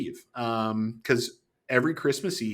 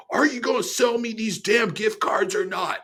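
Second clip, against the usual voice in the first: high, mock-angry demand; livid customer; 9 semitones higher than usual. Angry customer demanding gift cards, mock fury. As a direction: imitate an irate old man demanding. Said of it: "freaking livid".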